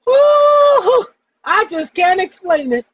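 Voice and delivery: high falsetto voice. Acted.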